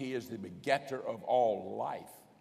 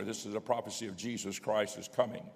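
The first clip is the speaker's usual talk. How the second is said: normal talking voice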